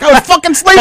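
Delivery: high, indignant protest; outraged he was asleep; dad protesting to daughter. Retold or imitated. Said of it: "I'm like".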